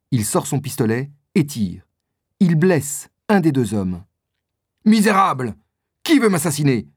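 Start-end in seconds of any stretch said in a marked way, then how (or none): 4.9-6.9 raised, higher cry of outrage at the ambush; a touch of furious indignation; indignant outcry. Acted. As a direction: hurl it at the hidden attacker. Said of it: "dit D'Artagnan".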